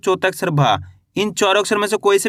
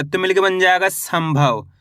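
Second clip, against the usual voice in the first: ordinary talk, nothing marked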